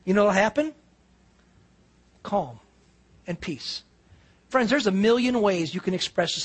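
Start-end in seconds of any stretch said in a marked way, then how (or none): 2.2-3.8 very soft, slow, lower; preacher softly naming the reward; hushed. Real, not acted. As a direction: let the words settle like a hush. Sermon point, dropping to hush.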